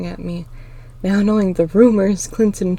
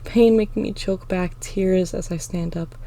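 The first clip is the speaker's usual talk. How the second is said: ordinary delivery, nothing marked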